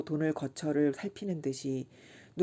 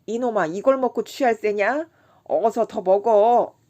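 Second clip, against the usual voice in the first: put-on drunken shout; 5 semitones higher, 10 dB louder than usual. A narrator's reading. Drunk Kim bellowing at Chisam to drink more, loud. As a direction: roar at your friend to drink. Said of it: "취한이는 부르짖었다".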